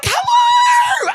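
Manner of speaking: hammed-up shrieking hello; excited greeting. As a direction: greet like a thrilled long-lost relative. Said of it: "She's like".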